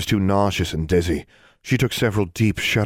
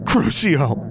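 higher, forceful curse; angry curse; 9 semitones higher than usual. A narrator's reading. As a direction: curse spat with anger. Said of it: "she said".

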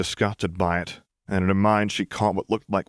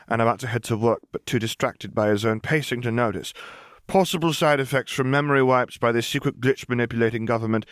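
put-on higher, brisk little girl reciting a report; Lily reading her notes; 5 semitones higher than usual. Lily reads her notebook observations aloud, higher and pleased. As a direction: read it like a proud clinical observer.

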